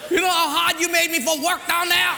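high, loud scolding yell; mock angry angel. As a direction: shout an exasperated complaint, hammed up. Angel slaps and scolds him.

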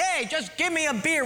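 preacher hams up a beer-belly husband; mock yelling husband. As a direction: holler from the armchair. Husband voiced in joke story.

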